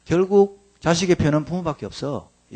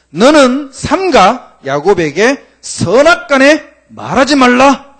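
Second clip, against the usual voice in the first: louder, higher and slow, proclaiming; voice of God; 9 semitones higher, 10 dB louder, slower than usual. Acted. Reading God's warning to Laban, slow solemn proclaim. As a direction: boom out God's command. God's words read from scripture.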